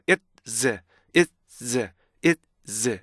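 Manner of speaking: IT'S split as it, gap, zz; "it's" in two detached beats; stop before Z. Shown as a demonstration. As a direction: stop on a T, then release the Z of "it's". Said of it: "Z의 스탑 사운드는 치잖아요".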